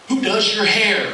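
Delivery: high, slow, nervous fan question; eager fan. Retold or imitated. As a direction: ask it like an excited fan at last. Shaking fan asking eagerly.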